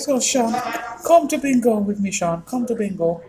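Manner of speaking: high-pitched mock mum pleading; imitating mum. Son imitating his mum begging him to come to bingo, high and pleading. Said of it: "she always wants to go".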